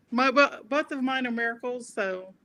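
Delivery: ordinary talk, nothing marked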